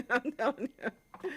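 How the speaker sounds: slow words said through a real laugh; amused, laughing aside. Real, not acted. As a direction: laugh while you insist it's true. Laughing about Joe in New Jersey.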